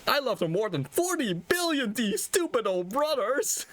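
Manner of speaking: high, tattling whine; high childish complaint to his mother; whiny son. Acted. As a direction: tattle to mom like a sulky kid. Hamlet whining to his mother.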